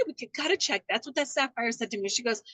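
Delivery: usual speaking voice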